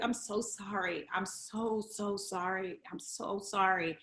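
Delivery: a touch of retold weeping, soft and slow; tearful apology. Patient apologizing to her therapist, softly tearful. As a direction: apologize softly through mild tears. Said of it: "I was like".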